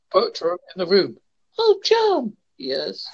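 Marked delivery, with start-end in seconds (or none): 1.6-2.3 high, hammed-up swoon; mock reality-show heroine; badly scripted actor. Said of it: "they're so badly scripted". 2.6-3.0 low, softer mock-drama reply; hammed-up low reply; badly scripted actor. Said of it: "they're so badly scripted".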